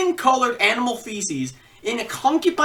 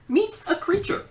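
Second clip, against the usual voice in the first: normal talking voice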